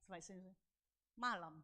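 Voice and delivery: low, soft, clipped "Selamat malam"; imitating an unenthusiastic good evening; retold cool reply. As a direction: mumble the greeting back with no interest. The other's cold reply, acted.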